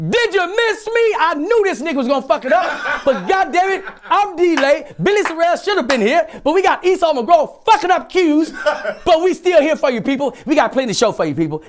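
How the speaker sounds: very high, strained stage holler; high-pitched mock performer shouting; hammed-up showman. Acts out a performer addressing a crowd.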